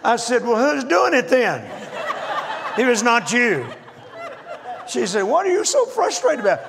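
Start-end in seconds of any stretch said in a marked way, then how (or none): 0.4-3.7 baffled and half laughing; laughing disbelief. Retold or imitated. Retold baffled outburst. 5.2-6.6 much higher, louder wife voice; wife's voice. Retold or imitated. Wife voiced in retold story.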